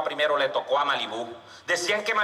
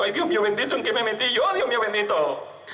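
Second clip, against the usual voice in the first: fearful prayer; 4 semitones higher than usual. Acted. Scared teller prays before the bull.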